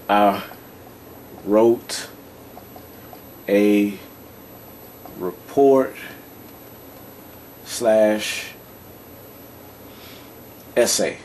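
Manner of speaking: one word at a time, long pauses; slow dictation. Shown as a demonstration. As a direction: dictate it at writing speed. Repeats example while writing it out.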